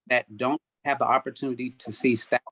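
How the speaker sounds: usual speaking voice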